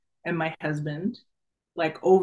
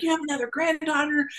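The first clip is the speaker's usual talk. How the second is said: much higher, eager announcement; eager news; 9 semitones higher, faster than usual. Retold or imitated. Daughter telling her dead father the news, high and eager. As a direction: share the news with him, delighted. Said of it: "and I'm like".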